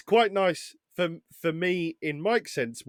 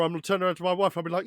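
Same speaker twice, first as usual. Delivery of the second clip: ordinary delivery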